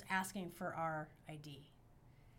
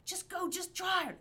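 hopeful inner voice; 9 semitones higher, 5 dB louder than usual. Retold or imitated.

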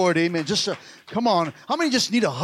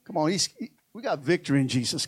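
ordinary talk

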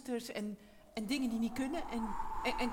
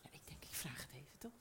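whispered aside; 11 semitones lower, 20 dB quieter, faster than usual. Real, not acted. Aside while recording starts.

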